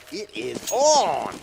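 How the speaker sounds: slow build to a big ON; drawn-out ON. Real, not acted. Caster declaring the banter is on, drawn-out ON. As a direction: stretch and lift the word ON.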